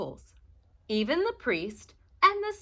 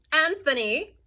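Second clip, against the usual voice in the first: put-on high, loud call of the old witch; old witch calling her helper; Strega Nona calling; 7 semitones higher, 5 dB louder than usual. A narrator's reading. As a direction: call him like a stern grandma. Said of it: "said Strega Nona".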